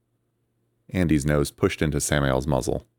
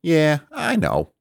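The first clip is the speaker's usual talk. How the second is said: high, slow, breezy character voice; smug and casual; casual reply; 13 semitones higher, 3 dB louder, much slower than usual. A narrator's reading. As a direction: shrug it off casually. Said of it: "he replied casually".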